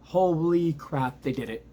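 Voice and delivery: high, loud burst of joy, stretched out slow; real overjoyed shout; excited outburst. Real, not acted. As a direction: let it out like your team just won. Team just won the game.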